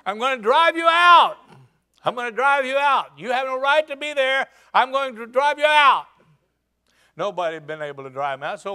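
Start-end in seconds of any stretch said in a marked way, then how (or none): 0.1-1.4 loud, high, insistent yell; shouted threat. Acted. David repeats his threat loudly. 2.1-6.1 loud, higher, insistent; David refusing to back down, shouting; repeated shouted threat. Acted.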